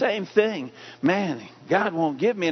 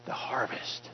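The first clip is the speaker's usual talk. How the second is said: whisper; 7 semitones lower, 14 dB quieter than usual. Real, not acted. Hushed end of a point.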